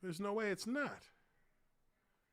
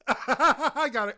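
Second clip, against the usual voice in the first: breaking up with laughter mid-line; laughing; 8 semitones higher, 11 dB louder, slower than usual. Real, not acted. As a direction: gasp it out through big laughs. Laughing hard watching the stream.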